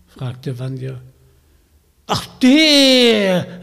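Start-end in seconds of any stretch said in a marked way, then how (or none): 2.1-3.6 put-on high, loud scornful old witch; dismissive witch cry. Witch dismissing the raven, high and loud. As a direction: brush him off like a cackling hag. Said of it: "rief die Hexe Knochenbein".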